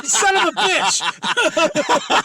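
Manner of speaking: loud, high, drawn-out cry with laughter; playful outrage. Real, not acted. To the co-host who trapped him, laughing outrage. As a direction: yell the curse through laughter.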